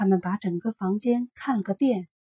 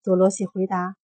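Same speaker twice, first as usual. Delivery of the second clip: ordinary delivery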